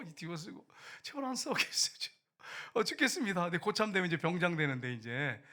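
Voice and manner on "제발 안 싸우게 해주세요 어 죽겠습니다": retold prayer, pitched high and loud; desperate pleading. Soldier praying to God to stop the platoon fighting, desperate pleading. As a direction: beg urgently, voice raised.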